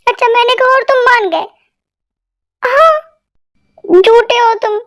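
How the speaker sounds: normal talking voice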